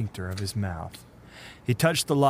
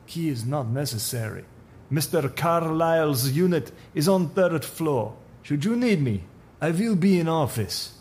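put-on higher Russian accent, stiffly polite; polite but firm Russian accent; 6 semitones higher, 3 dB louder than usual. Supervisor backs off from the stranger, stiffly polite, in a Russian accent. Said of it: "Russian accent".

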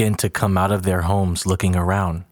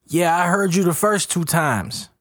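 high, exasperated half-yell; yelling back; 10 semitones higher than usual. A narrator's reading. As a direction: throw it back at them, fed up. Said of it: "Jabri yells back".

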